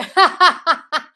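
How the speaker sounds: loud, high burst of laughter; real laughing. Real, not acted. Coach amused at the group's common reaction, laughing loudly.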